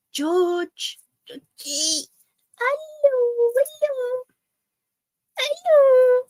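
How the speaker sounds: very high squeaky hello; stretched high-pitched greeting to a puppy; teaching dog hello. Shown as a demonstration. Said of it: "I'm teaching him to say hello".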